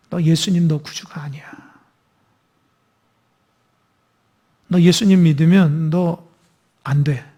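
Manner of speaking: low, hissed whisper of a tempting world; voicing the world's persecution in a sinister whisper. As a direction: breathe the threat in low like a tempter. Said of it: "막 이렇게 핍박이 오는 거예요".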